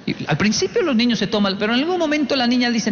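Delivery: normal talking voice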